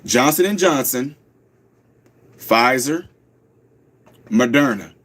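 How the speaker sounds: slow, low, each name set apart by pauses; name them one by one, slowly; said real slow. Shown as a demonstration. Host repeats the names slowly for the audience. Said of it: "I'll say it real slow".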